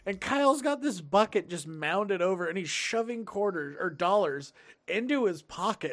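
usual speaking voice

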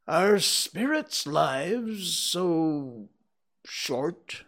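put-on slow, wondering old-man question; Scrooge voice. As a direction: ask it slowly, full of unease.

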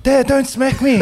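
put-on high, loud little-kid plea; squeaky mock child begging dad; mock kid pleading. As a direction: beg like a scared child. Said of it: "He turns around and is like".

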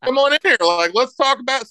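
mock cheery dad. Dad calling his cursing son in, mock cheery and high. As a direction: play the overly upbeat dad calling his kid in.

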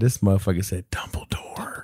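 hushed, breathy repeat of the joke; whispered aside. Real, not acted. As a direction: whisper it like a secret.

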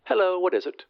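high, thin phone voice; voice on the telephone. A narrator's reading. A voice answers the glassblower's call, high telephone voice. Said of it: "said a voice".

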